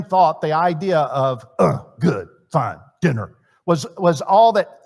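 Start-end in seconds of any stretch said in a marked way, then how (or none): 1.6-3.4 low, terse grunts, one word at a time; mock the husband who barely talks; caveman-style monosyllables, pitched down; grunting husband. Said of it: "It is like speaking in grunts".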